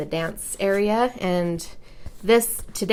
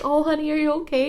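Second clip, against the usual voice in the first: concerned mom; 9 semitones higher, much faster than usual. Retold or imitated. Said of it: "And I'm like".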